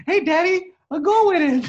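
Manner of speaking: put-on high childlike voice reporting a goal; mock little girl. Listener imitating daughter in backseat, high childish. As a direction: squeak it like an excited five-year-old.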